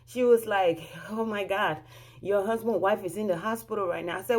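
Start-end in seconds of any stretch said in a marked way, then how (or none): none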